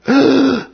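high, sudden startled gasp; sharp gasp. Retold or imitated. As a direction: suck in air in alarm. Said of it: "it's a gasping".

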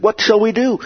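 high, desperate cry from the stung crowd. Retold or imitated. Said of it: "same kind of desperation".

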